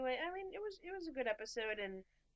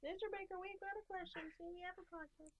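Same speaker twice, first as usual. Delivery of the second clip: high, soft put-on pleading voice; small, high mock-interviewer voice; pretend fan question; 10 semitones higher, 7 dB quieter than usual. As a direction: call up timidly to a star. Pretends to address actor with question.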